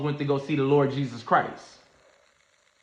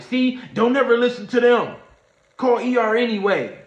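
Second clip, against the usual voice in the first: high, louder scolding voice; scolding friends; 7 semitones higher, 5 dB louder than usual. Retold or imitated. Friends arguing over him, high and loud. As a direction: scold the others for listening. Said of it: "That's what I'm hearing him say".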